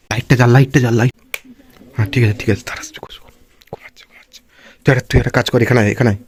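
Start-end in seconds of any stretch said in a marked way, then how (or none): 2.4-4.4 hushed whisper, almost no voice. Real, not acted. Sneaking toward his sleeping sister, whispering. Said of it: "আস্তে আস্তে কথা বলছি".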